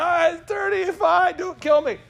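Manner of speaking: very high mock-scared voice; hammed-up frightened pleading; mock panicked surrender. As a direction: give in as if threatened. Customer giving in to threats.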